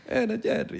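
put-on high, soft Italian-accented Spanish. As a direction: mimic an Italian speaking Spanish. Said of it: "con un acento".

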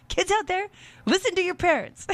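put-on high lightly Serbian-accented call to kids. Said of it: "I'm doing the accent".

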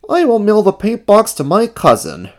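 high, earnest character voice; high-pitched puppet-like resolve; Ernie's voice. A narrator's reading. Ernie decides to make amends.

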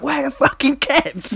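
lampooning a bad movie London accent; Charlie Hunnam's bad accent. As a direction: squeal it out in a parodied London accent. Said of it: "it's also the peak of the terrible accents".

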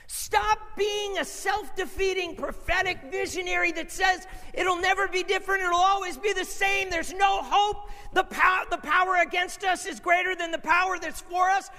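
high-pitched, loud preaching shout; shouted rebuke. Acted. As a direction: drive the rebuke home at full voice.

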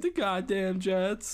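put-on high, slow childish grumble; little kid voice. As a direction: say it like a clueless kid. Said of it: "what little kids do".

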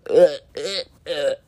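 short repeated grunts of disgust; disgusted ughs. Acted. Single vlogger reacting to how dating has worsened, disgusted. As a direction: recoil with little ughs.

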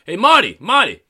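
high, loud mock call across a restaurant. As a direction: hail Marty like an old pal. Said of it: "I walk by and say".

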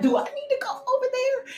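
mom's high urgent question; imitating mom.

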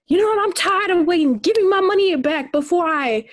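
high, impatient demand; angry guest. Retold or imitated. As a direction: snap at the waiter, fed up. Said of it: "This person was like".

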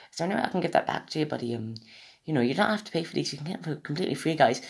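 quick, high, soft Australian drifting Celtic; a bad Australian sliding into Irish or Scottish; botched Australian accent. Podcaster as kind streamer telling viewers it's free, botched Australian. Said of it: "I sound Irish now, Scottish".

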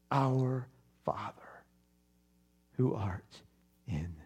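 soft, slow, whispered prayer; quiet prayer. Real, not acted. Preacher closes the sermon praying to his heavenly Father, hushed. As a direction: pray gently as if alone with God.

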